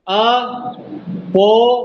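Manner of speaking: Apologetic slowly split अ, पो, लो, जेटिक; long, separated अ-पो-लो before जेटिक; syllable demonstration. Shown as a demonstration. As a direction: pause after each syllable of apologetic. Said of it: "इसमें देखो कैसे syllable".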